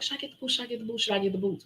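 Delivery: soft, hushed asking herself; mulling it over under her breath; inner debate. Retold or imitated. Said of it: "I was like".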